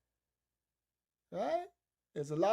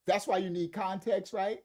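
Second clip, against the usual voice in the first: usual speaking voice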